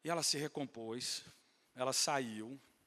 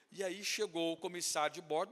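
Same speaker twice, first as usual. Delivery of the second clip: ordinary talk, nothing marked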